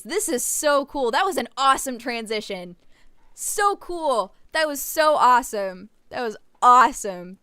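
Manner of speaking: loud, eager praise; excited. Real, not acted. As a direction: rave about it happily. Raving about transition.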